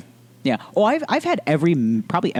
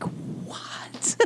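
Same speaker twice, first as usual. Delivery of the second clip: whisper; 12 dB quieter than usual. Retold or imitated. Shocked reaction to the doctor's news.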